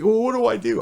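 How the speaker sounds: put-on whiny kid. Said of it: "And he".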